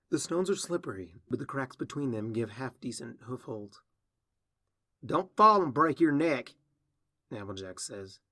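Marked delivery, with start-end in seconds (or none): 5.0-6.5 snide warning. Acted. Sister calls up to climber.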